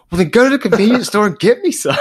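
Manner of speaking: high, loud, fast mock yell; imitated coach's yell. Coach yelling at player to fetch dip, fast imitated shout. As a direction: fire it out as a hammed-up coach's order. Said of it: "he just starts yelling".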